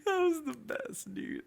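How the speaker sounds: loud, very high, stretched squeal; still cracking up. Real, not acted. Storyteller relishing his own humiliation, real laughing squeal. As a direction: push it out through real laughter.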